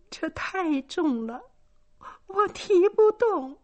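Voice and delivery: high, slow tearful whine; whimpering daughter. A narrator's reading. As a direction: whimper it slowly, near sobbing. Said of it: "接着说". (高声、缓慢地哭诉)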